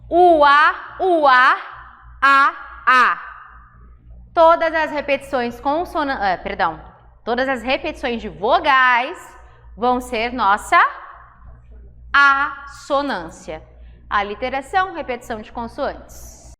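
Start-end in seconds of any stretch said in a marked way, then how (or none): 0.1-3.2 louder, stretched vowel sounds; vowels sounded out loud. Shown as a demonstration. Teacher to class, vowels called out loud. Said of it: "Percebem aqui?". 12.1-13.6 slow, stretched term. Shown as a demonstration. Teacher to class, term drawn out slowly. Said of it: "teacher defining term".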